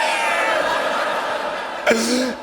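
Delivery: breathy, wheezing laugh; hysterical laughter. Acted. Colleague laughing at the comedian's blunder, wheezing laughter. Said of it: "he is shaking with laughter".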